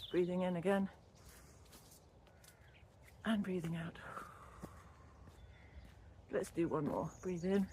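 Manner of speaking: soft, unhurried breathing cues; guided breathing. Real, not acted. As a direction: talk them gently through each breath. Leads a breathing exercise.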